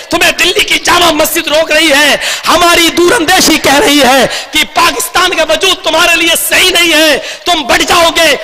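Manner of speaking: high, urgent plea from the mosque steps; ringing, sustained oratorical appeal; Maulana Abul Kalam Azad. Retold or imitated. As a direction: implore the departing crowd to stay. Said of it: "खड़े होकर कह रही थी".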